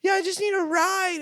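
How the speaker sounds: high-pitched whimpering plea; mock weeping. Drug lady asking driver for a ride, tearful mock plea. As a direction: imitate a weeping woman begging. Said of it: "like, weeping".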